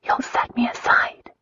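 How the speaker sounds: wife's high, breathy whisper; worried feminine whisper. A narrator's reading. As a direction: whisper your worry to your husband. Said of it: "she whispered".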